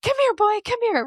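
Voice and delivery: high, loud, eager dog call. Acted. Owner trying to get a dog's attention, dog-calling voice. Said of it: "They'll change the way they talk".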